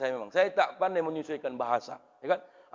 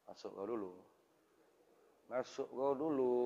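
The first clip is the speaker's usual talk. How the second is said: hushed coded order; 3 semitones lower, 10 dB quieter than usual. Retold or imitated. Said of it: "berantem pakai kode".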